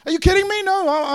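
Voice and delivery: high, loud, outraged cry; loud disbelief. Real, not acted. Preacher to congregation, loud outraged disbelief. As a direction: blast the disbelief at them.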